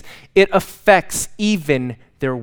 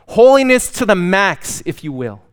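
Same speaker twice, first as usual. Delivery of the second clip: usual speaking voice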